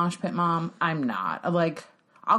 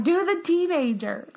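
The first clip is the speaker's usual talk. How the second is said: put-on higher-pitched kid's request; raised-pitch mock kid demand; retold eager daughter; 10 semitones higher than usual. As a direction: imitate a daughter begging for an impression.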